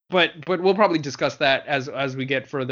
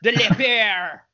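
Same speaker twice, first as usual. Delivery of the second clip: put-on French accent; 7 semitones higher, slower than usual. Mock French villain name.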